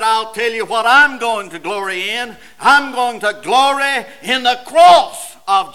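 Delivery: ringing preacher's climax; impassioned preaching. Acted. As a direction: proclaim it from the pulpit, swelling in force. Preaches Paul's words at force.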